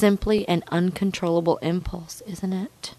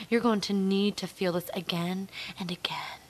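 ordinary talk, nothing marked

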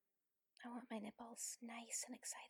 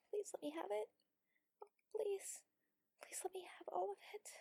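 high, breathy plea; desperate pleading; 7 semitones higher, 5 dB louder than usual. Acted. Begging a partner, high and breathy. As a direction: beg with the pitch lifted.

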